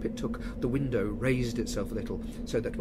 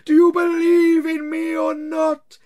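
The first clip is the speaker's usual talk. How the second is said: high, slow, loud moaning spectre; wailing ghost; 15 dB louder, slower than usual. Acted. Marley's ghost wails at Scrooge, slow and loud. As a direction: drag each word out like a wail from the grave.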